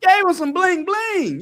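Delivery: high, loud playful quip; playful excitement. Real, not acted. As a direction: tease it with a grin. Joking about Joseph's gold chain.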